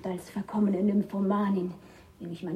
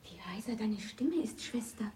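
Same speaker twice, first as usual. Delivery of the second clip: soft unvoiced whisper; 7 dB quieter than usual. Sister whispers to the hidden man in darkness. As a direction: keep it hushed.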